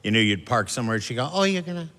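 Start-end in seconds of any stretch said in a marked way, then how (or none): 1.3-1.9 higher, sweetly needling; higher passive-aggressive mom. Retold or imitated. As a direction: fake politeness with a dig. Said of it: "real passive-aggressive".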